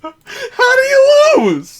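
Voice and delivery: very high, slow, crying yell; anguished scream. Real, not acted. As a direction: wail it in despair. Raging at tic-tac-toe loss.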